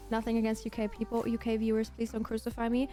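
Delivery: ordinary talk, nothing marked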